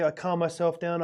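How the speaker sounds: ordinary delivery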